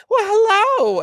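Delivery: very high cartoon flapper greeting; Betty Boop. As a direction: squeak it out in a high, cutesy character voice. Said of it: "Betty Boop was literally sitting".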